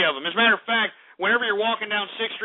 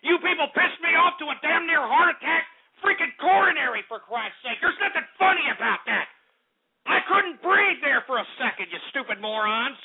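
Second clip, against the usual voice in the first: higher-pitched, exasperated outburst; indignant rant; 5 semitones higher than usual. Real, not acted. Host berates listeners for mocking his breathlessness, indignant. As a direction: scold them in real outrage.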